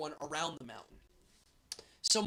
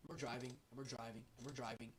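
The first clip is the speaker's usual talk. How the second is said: fading softer with each repeat; trailing off; 6 semitones lower, 15 dB quieter than usual. Retold or imitated. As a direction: drift down into a hushed drone. Monotonous long drive retold.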